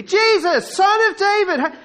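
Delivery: high, loud repeated plea; full-voiced desperate calling; crying out. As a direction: holler it to be heard over a crowd. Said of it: "How many times do you think he cried out".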